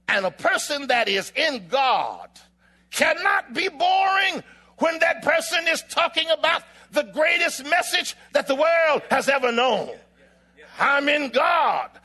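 high, loud pulpit proclamation; impassioned sermon shout; full-force preaching. Real, not acted. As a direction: drive it home to the congregation.

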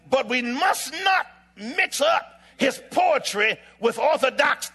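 high, loud, stretched-out pulpit cry; booming sermon build; full-force preaching. Real, not acted. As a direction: proclaim it slowly to the whole church.

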